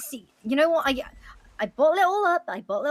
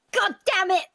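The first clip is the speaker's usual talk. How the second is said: sudden burst of real anger, louder; angry outburst; 4 semitones higher, 5 dB louder than usual. Real, not acted. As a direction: snap out a frustrated curse. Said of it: "I get so goddamn angry".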